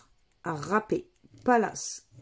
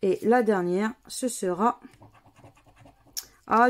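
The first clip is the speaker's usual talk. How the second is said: ordinary delivery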